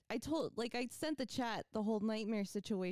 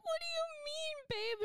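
high-pitched retold alarm; imitated panicky question; panicked questioning. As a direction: act out a frightened partner, voice way up. Retells panicked exchange with boyfriend.

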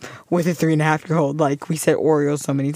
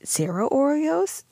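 put-on high little-kid question; 9 semitones higher, 5 dB quieter, slower than usual. Son bargaining for cookies.

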